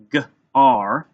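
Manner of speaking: G-R as g, pause, loud ar; tapped-out sounds. Shown as a demonstration. Teacher tapping first syllable gar, segmented. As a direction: split gar into two sounds. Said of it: "So gar. Ready?".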